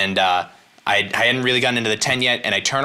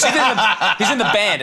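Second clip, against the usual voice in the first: snapping impatiently; 8 semitones higher than usual. Retold or imitated. Little girl snaps at the comedian about the band, mock impatient. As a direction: bite back at him, fed up. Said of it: "She snaps at me".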